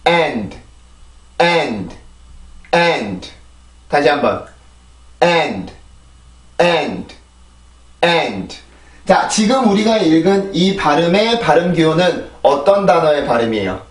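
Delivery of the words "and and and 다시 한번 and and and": 'And' as one falling syllable, d lightly released; pronunciation drill. Shown as a demonstration. Teacher modelling for learners, one-syllable English 'and'. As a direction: stress the vowel of 'and', closing on n and d. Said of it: "D 발음까지 들어가요".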